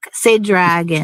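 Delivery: ordinary talk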